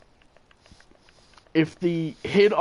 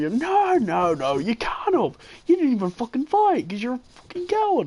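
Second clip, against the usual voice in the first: high-pitched, whiny mock outrage; mock bitching; 9 semitones higher than usual. Angry player bitching at his opponent, mock high-pitched outrage. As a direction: imitate an irate gamer throwing a fit. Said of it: "he starts bitching at me".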